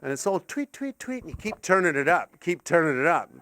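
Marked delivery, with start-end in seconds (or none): 0.4-1.2 high, soft chirps; imitated bird tweets. Comic telling of bird videos, soft high tweeting. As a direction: chirp softly like little birds.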